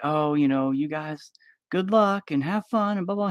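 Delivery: high, cheery granny send-off; grandma waving the couple off, high and sugary; sweet grandma. Retold or imitated. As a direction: chirp it up like a doting grandmother. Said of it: "the grandma's gonna be like".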